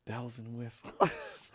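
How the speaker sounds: slow quiet drawl; slow drawn-out. Pretend. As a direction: stretch each word out slowly. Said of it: "I'm just gonna do sound effects".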